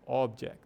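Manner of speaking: "object" with a strong OB; stress on first syllable. Shown as a demonstration. Teacher repeating the noun example, "object" stressed at the start. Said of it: "a ênfase tá no começo".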